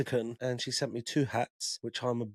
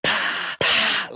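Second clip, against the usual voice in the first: high, loud breathy gasps and spitting sounds; spitting out hot sweets; 10 semitones higher, 7 dB louder, much slower than usual. Retold or imitated. Telling hosts about spicy Mexican sweets, mock spluttering. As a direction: puff and spit like your mouth is on fire. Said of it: "I was like".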